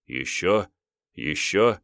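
plain reading voice

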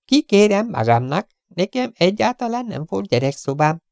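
high, curt, insolent gangster voice; rude Chiefen. Acted. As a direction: sneer the intruder off. (magas, pimasz, goromba hang)

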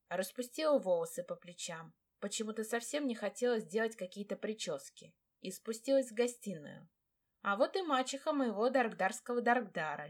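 ordinary delivery, nothing marked

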